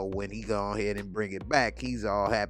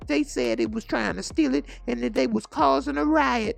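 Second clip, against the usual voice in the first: much higher and louder, distressed; upset high voice; 7 dB louder than usual. Acted. Daughter tells Bumpy about Cecil in a high upset voice. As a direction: tell it like a shaken young woman.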